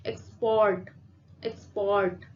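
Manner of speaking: Export with the pressure on port; stress on port. Shown as a demonstration. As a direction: lean on the second syllable, port, in export. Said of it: "दबाव डाल रहे हैं वो port पे".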